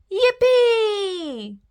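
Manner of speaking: high, bright cry of glee; joyful squeal. Acted. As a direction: squeal it like a happy piglet. Piglets dancing happily.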